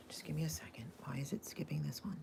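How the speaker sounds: hushed aside whisper off mic. Real, not acted. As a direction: whisper it to yourself, flustered. Host fumbles playback, aside.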